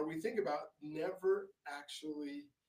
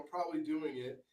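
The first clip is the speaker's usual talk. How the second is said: ordinary delivery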